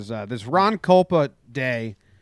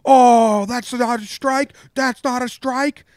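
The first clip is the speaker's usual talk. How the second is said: high, slow, hammed-up umpire yell, much higher and louder; mock yelling; 9 semitones higher, 5 dB louder, slower than usual. Umpire yelling back at the dugout, mock high slow yell. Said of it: "He starts yelling like".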